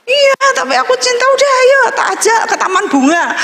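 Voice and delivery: high, fast coaxing voice; lightly voiced dad coaxing; coaxing father. Father offering a trip to cheer her up, fast high coaxing.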